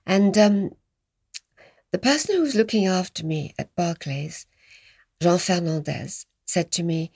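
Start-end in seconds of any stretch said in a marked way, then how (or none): none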